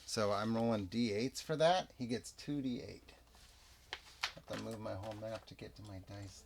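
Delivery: ordinary delivery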